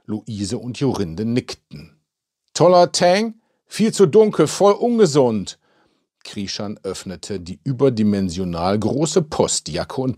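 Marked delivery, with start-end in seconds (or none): 2.5-5.6 high, loud, indignant contradiction; loudly dismissive, pitched way up; scornful objection. A narrator's reading. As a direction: scoff at it in a raised, loud voice. Villager mocks compliment on complexion.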